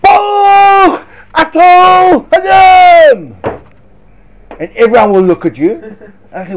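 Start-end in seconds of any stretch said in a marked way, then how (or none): 0.0-3.3 loud, high, drawn-out shout of the blessing; a slow booming public blessing. Retold or imitated. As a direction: call it out for everyone to hear. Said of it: "you go".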